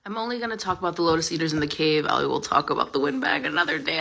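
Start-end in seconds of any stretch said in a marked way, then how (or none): none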